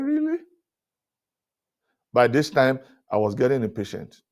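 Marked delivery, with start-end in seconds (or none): 0.0-0.4 much higher disbelieving "Really?"; a guest stunned at the front desk; incredulous. Retold or imitated. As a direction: leap up in disbelief. Incredulous at hotel cancellation.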